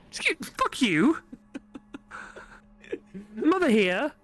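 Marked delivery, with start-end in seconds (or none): 0.1-1.2 mock offended retort.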